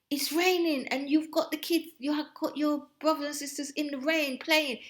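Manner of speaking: imitating mum telling her off for the rain; scolding mother. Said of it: "Because she said".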